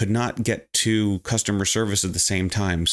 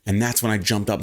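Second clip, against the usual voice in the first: ordinary delivery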